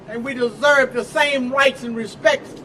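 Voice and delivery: shouted fiery rally oratory; rally speech. Real, not acted. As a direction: preach it to the crowd, full force.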